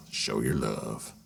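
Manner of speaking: very deep, slow soul bass. Retold or imitated. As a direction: croon it low and slow.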